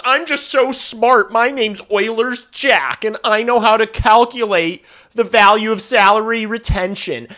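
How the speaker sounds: high, loud, smug nerd voice; mocking Twitter know-it-all. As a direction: sneer it like a smug armchair expert. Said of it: "I hate people who talk like this".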